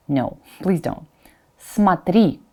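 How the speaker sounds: ordinary delivery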